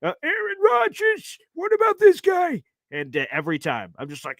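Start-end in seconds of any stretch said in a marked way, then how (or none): 0.0-2.6 high, slow old man imitation; hammed-up old man asking. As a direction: quiz him like the eager old fan. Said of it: "And it'll be like".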